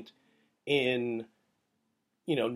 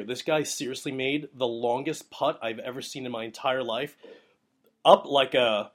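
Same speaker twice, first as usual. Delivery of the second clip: usual speaking voice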